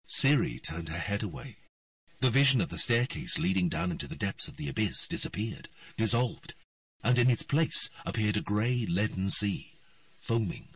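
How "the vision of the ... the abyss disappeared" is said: usual speaking voice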